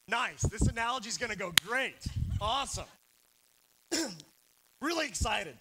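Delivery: deadpan sarcastic cheer; dry sarcasm. Real, not acted. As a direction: fake excitement, tongue in cheek. Sarcastic reaction to few disc golfers.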